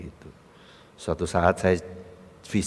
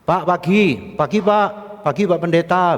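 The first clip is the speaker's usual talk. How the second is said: high, loud acted hello; eager greeting; 9 semitones higher, 7 dB louder than usual. Church guards greeting their pastor, eager greeting. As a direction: call out respectfully to the pastor.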